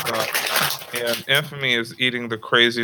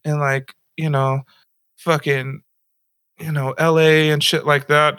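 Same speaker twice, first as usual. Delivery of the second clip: put-on high sympathetic girl voice; 6 semitones higher than usual. Retelling the barista's sympathy for fire victims, high gushing voice. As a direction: gush sympathy like a bubbly cashier. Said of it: "And she's over here".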